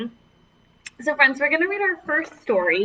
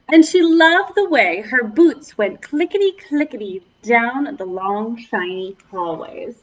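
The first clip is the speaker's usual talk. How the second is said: story reading; 4 semitones higher, 5 dB louder than usual. Acted. Reading story aloud to kids.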